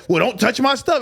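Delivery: high-pitched mock indignation; arguing. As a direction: imitate a huffy old lady. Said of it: "they get to arguing".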